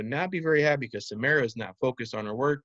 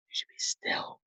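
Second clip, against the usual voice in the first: hushed, breathy whisper; 8 dB quieter than usual. Shown as a demonstration. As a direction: whisper it softly. Teacher modelling stillness.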